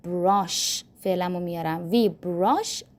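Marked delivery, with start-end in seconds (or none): 0.1-0.8 very slow, clear English model; slow model phrase. Shown as a demonstration. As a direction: build the sentence slowly for learners. (خیلی آهسته و شمرده)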